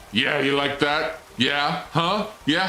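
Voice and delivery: high, goading questions; mock taunting. Player wetting the robot, mock taunting.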